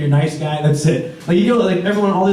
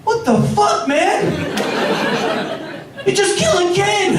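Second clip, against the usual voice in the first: shocked outburst; 12 semitones higher than usual. Retold or imitated. Crew reacts to pirate stabbing Peter.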